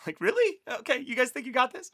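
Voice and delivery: high, mocking really?; teasing disbelief. Teacher teases the disciples, high and sceptically amused. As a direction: needle them, sceptical and amused.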